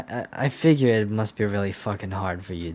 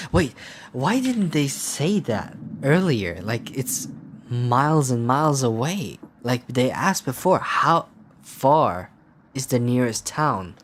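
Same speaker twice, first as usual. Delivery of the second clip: loud, higher, exasperated complaint; indignant rant; 5 semitones higher, 5 dB louder, slower than usual. Real, not acted. Player protesting the late news about the town, indignant. Ranting at the game's late reveal.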